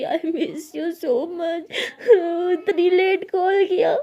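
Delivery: tearful, voice breaking, slowed; crying. A narrator's reading. Abira crying to her friend on the call, tearful. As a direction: sob it out on the phone. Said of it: "वो रोते हुए कहती है".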